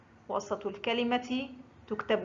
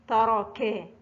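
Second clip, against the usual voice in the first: usual speaking voice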